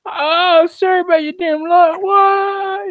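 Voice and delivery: high, put-on roaring dinosaur voice; T-Rex voice. T-Rex jeers at the country it's wrecking, in a high put-on voice. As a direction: play a cocky T-Rex mocking its victims. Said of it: "I'd be a bloody T-Rex".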